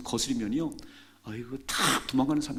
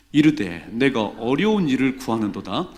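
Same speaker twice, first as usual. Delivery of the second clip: scripture reading; 2 semitones lower, 7 dB louder than usual. Real, not acted. Congregation reads scripture aloud.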